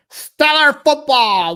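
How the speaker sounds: over-the-top mock football fan; Tree impression. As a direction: belt it out like a goofy superfan mocking his buddy. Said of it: "do a urinating Tree impression".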